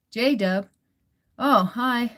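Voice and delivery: usual speaking voice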